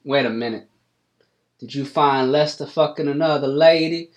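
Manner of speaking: put-on a gossipy old farmer jumping to conclusions; hillbilly farmer Cletus. GM voices Cletus Ray.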